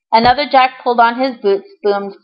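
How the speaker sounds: plain reading voice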